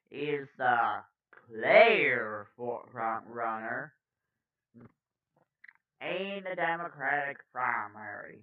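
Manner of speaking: slow, emphatic news-anchor delivery; news read. Acted. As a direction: punch key words, lingering on figures.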